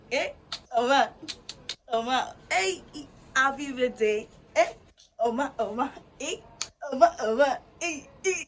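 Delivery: loud, high calls across the room; calling out loud. Real, not acted. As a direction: call Oma to come over. Calling Oma loudly for birthday.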